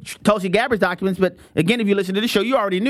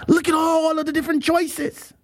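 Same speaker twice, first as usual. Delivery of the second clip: excited tourist; 6 semitones higher than usual. Retold or imitated. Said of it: "the one guy was like".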